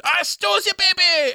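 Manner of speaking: very high, squeaky mock French imp; cartoonish high French-accented squawk; helium French accent. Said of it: "doing a French accent, having inhaled helium".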